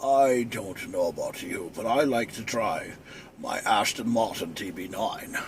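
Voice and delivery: a smooth spy murmur; Sean Connery. Retold or imitated. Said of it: "I can sound like Sean Connery".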